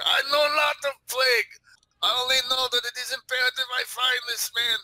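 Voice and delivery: high, forceful denial; indignant Russian character. Pretend. Russian denying plague to the sergeant, indignant.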